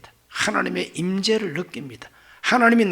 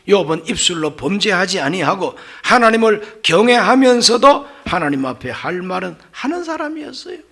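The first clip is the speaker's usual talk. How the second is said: normal talking voice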